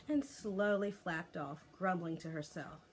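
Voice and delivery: usual speaking voice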